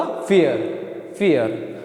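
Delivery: फियर with "ie" as "ee-a"; German pronunciation. Shown as a demonstration. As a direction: model फियर slowly as "fee-a". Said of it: "तो ईया जैसी आवाज़ आएगी".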